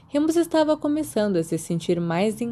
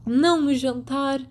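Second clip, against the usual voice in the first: exasperated; 6 semitones higher than usual. A narrator's reading. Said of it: "parecendo exasperada".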